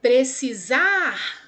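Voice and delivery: PRECISAR slow, with a long ZAR sound; stretched last syllable. Shown as a demonstration. Teacher gives pupils another oxytone, stretched stress. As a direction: call out "Precisar", holding the final SAR. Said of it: "também seria".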